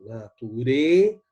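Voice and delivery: "Nature" with RE loud and drawn out; "Nature" rising into a loud, stressed RE; stress on final syllable. Shown as a demonstration. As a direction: hit the last syllable of "Nature" hard. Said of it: "Na última tônica".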